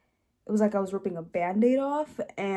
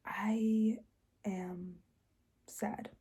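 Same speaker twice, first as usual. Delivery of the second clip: quiet, halting retold confession; breaking down; 8 dB quieter, much slower than usual. Daughter confessing sadness to parents, halting and subdued. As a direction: let each word out with difficulty, fading away. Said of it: "I just break down".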